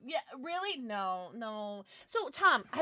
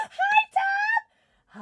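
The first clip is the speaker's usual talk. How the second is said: high squealing hello; excited squeal; 11 dB louder than usual. Real, not acted. Streamer greeting her friend Tom in an excited squeal. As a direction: gasp then shriek the name with delight.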